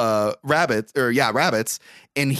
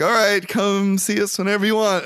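higher-pitched mock-friendly farewell; rabbits' polite send-off; 7 semitones higher than usual. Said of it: "And they're like".

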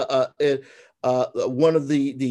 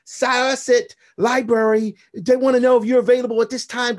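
publisher's eager call; 8 semitones higher, 4 dB louder than usual. Retold or imitated. Voicing the publisher's booking call.

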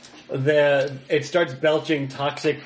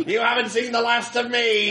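high-pitched hammed-up parting threat; thwarted villain; 9 semitones higher than usual. Tied-up industrialist threatens the hero, high villain voice. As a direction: vow revenge in a cartoon villain voice.

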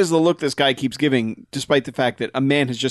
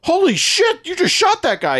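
shocked outburst. Retold or imitated. Mocking a bystander who shrieks in shock. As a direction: yelp it out in mock shock, way up high. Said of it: "he's not like".

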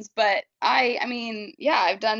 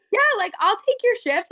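eager; 11 semitones higher, 3 dB louder than usual. Retold or imitated. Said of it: "and I was like".

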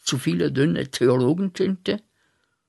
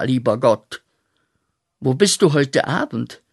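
verzweifeltes Schreien; 3 semitones higher, 7 dB louder than usual. Acted. Schreit Gott an.

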